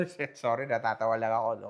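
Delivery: ordinary talk, nothing marked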